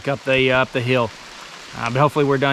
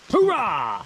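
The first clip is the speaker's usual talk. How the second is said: higher, punchy military cheer; loud gung-ho soldier; 6 semitones higher, 3 dB quieter than usual. Pretend. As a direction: shout it like a fired-up soldier. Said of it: "No, no hoorah".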